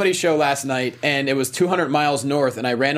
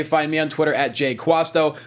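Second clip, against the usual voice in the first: normal talking voice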